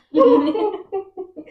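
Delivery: high, loud burst of laughter; laughing at herself. Real, not acted. Laughing at riddle explanation.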